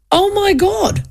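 high, stunned oh my God; retold shocked gasp. Flatmate seeing the Twin Towers on telly, high retold shock. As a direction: mimic his disbelief lightly.

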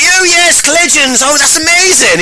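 very high posh exclamations; gushing posh man. Retold or imitated. Posh man recalling the guest's work, high gushing posh voice. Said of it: "he is actually posh".

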